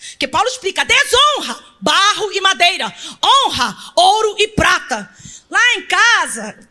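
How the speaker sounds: loud, high, slowing preacher's climax; full-voiced sermon climax; preaching at full force. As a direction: drive the point home to the congregation.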